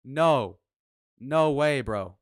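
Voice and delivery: loud no way, half yelled; loud disbelief. Real, not acted. Streamer finding the wrong account, loud disbelief. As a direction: cry out in disbelief.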